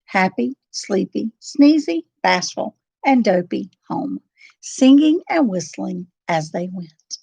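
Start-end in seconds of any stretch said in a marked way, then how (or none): none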